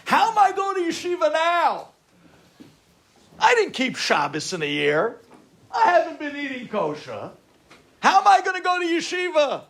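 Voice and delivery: high, loud, exasperated excuse-making; protesting. Retold or imitated. Student arguing himself out of yeshiva, loud high protest. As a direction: cry out each objection at full voice. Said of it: "Listen to the way the Yetzer Hara works".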